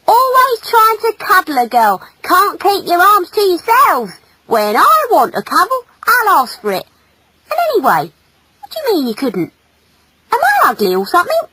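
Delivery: high, loud scolding; huffy girl's rant; indignant female. Acted. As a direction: tell him off in high-pitched exasperation.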